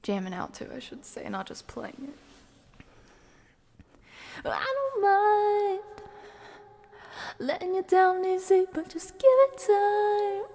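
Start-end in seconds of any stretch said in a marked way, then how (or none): none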